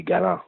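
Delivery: Galant as ga-LAHN, nasal ending, silent T; Acadian French pronunciation. Shown as a demonstration. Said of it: "if you said it in French".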